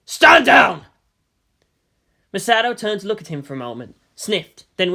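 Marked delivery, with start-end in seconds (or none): 0.1-0.9 loud commanding shout. A narrator's reading. Delta One shouts at Misato, loud command. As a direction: bark her name across the deck. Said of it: "Delta One shouted".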